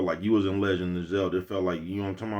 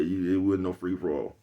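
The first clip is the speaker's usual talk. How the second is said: normal talking voice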